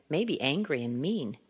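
normal talking voice

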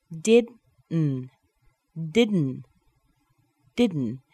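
"Didn't" with D released through the nose; held D into N. Shown as a demonstration. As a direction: hold the D in DIDN'T and hum straight into N. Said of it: "we leave the tongue on the teeth".

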